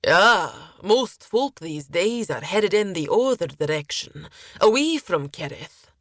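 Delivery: lightly voiced chatty sailor; sailor character. Sailor remarking on travellers heading away from Kerith, lightly in character. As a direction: play a tavern sailor making conversation.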